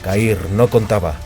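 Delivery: ordinary delivery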